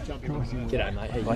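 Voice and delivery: distant, off-mic greeting; far from the mic. Real, not acted. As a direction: speak from well back before the mic is on. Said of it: "He's clipping on here".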